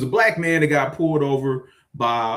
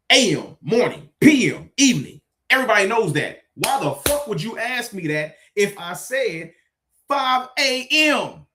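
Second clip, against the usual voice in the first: exasperated rant; 8 semitones higher than usual. Real, not acted.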